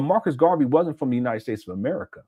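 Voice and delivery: ordinary talk, nothing marked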